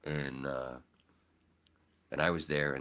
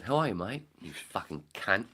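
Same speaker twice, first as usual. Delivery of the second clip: imitated Australian friend; 5 semitones higher, 4 dB louder than usual. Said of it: "She said".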